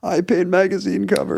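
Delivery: high-pitched comic painter voice; Norman Rockwell. Acted. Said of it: "That's my Norman Rockwell impression".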